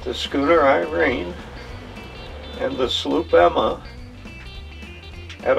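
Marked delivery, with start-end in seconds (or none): none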